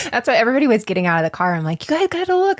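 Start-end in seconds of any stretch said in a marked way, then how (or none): 1.9-2.6 high-pitched excited self-quote; excited urging. Retold or imitated. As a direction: urge them eagerly, pitch jumping up. Retold urging family to see rainbow.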